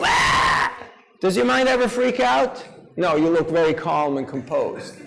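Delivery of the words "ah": a breathy, unvoiced cry of panic; mock panicked shout. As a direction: act out a mind freaking out with a short gasp of a yell. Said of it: "whenever your mind starts freaking out and going".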